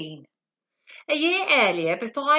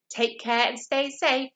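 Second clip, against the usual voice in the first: ordinary delivery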